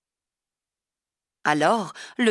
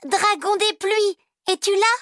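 put-on a young girl calling out, much higher and louder; 14 semitones higher, 5 dB louder than usual. A narrator's reading. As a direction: call the dragon's name far into the dark. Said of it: "Elle entra et appela".

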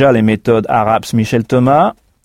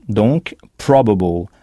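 "probable" slowly, hitting PROB; stressed first syllable; 3 semitones lower, slower than usual. Shown as a demonstration. Teacher slows down and hits PROB for learners. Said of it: "On frappe en quelque sorte sur cette syllabe".

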